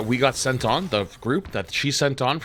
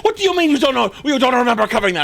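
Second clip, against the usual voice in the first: much higher, louder indignant yell; angry teacher yelling; 13 semitones higher, 7 dB louder, much faster than usual. Retold or imitated. Retelling a student's visit, teacher yelling at him. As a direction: mimic the teacher snapping back at you. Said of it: "she got pissed".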